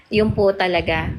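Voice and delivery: ordinary talk, nothing marked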